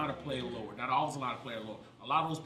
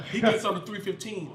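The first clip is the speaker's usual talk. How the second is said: ordinary talk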